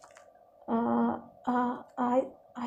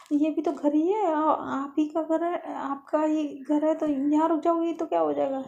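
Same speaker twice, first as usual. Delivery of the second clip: higher, louder protest from a story character; indignant; 6 semitones higher, 5 dB louder than usual. Acted. Lovely protesting to her friend that she may stay, indignant. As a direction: argue back, pitched up and forceful.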